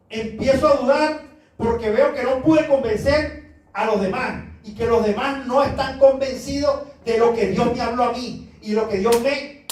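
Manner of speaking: ordinary delivery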